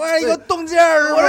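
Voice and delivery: high, loud whining yelp; mock yelp. Dog yelps at his owner's friend. Dog yelping in retold scene.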